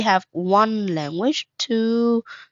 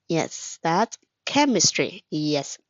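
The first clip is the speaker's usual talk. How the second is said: ordinary talk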